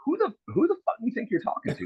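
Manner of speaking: imitating a nasty high-pitched snap, held back in volume; retold angry comeback. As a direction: replay the insult with mock outrage. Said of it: "I got nasty with him".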